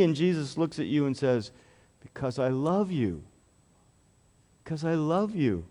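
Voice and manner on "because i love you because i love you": tender. Acted. Jesus answering child tenderly.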